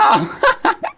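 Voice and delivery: sudden high laugh; high burst of laughter. Real, not acted. Laughs at own punchline.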